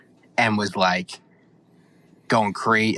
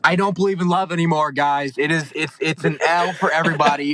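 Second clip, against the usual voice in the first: higher-pitched, exasperated declaration; dismayed outburst; 5 semitones higher than usual. Real, not acted. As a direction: proclaim heartbreak to the whole room. Mock-despairing rant about the show.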